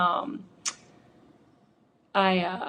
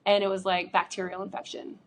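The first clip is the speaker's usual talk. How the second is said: normal talking voice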